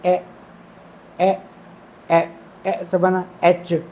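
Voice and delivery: slow, separated ए sounds; short e demo. Shown as a demonstration. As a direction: tap ए short then build एच. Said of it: "light dot है".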